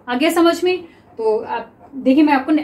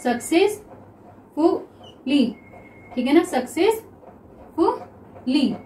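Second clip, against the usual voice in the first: Successfully stretched slowly; slow drawn-out reading; 4 dB quieter, much slower than usual. Shown as a demonstration. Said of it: "next देखिए successfully". (Successfully को धीरे पढ़ना)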